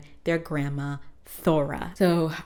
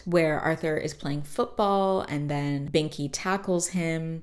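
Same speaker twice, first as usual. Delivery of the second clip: usual speaking voice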